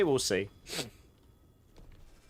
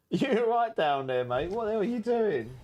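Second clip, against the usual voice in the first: amused pet talk; 7 semitones higher than usual. Real, not acted. Streamer amazed at his upside-down cat, higher amused disbelief. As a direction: marvel at the silly cat.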